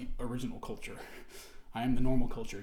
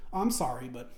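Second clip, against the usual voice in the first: mock apology; 7 semitones higher, 3 dB louder than usual. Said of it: "and so it's just".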